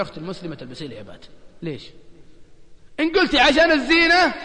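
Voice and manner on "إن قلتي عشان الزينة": raised, pressing challenge on "قلتي"; preaching emphasis. Real, not acted. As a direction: push the point home with force. (تأكيد وعظي بصوت مرتفع)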